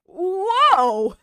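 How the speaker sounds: loud, high mock offense; fake hurt. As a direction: ham up being wounded. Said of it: "faking being hurt".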